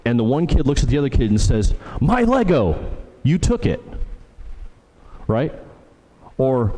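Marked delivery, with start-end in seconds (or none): 2.0-3.8 MY LEGO thrown high up front, "you took it" after; indignant kid emphasis. Acted. One kid confronting another over a toy, indignant emphasis on "My Lego". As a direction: act a possessive child, pitch leaping on "My Lego". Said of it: "you can tell by the way they say it, the emphasis".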